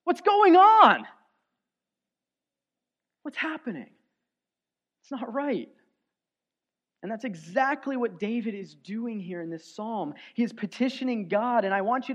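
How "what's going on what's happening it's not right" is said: anguished outcry to God; crying out to God. Retold or imitated. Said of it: "we cry out to God".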